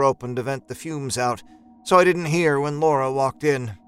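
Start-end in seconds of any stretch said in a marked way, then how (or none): none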